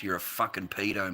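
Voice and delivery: usual speaking voice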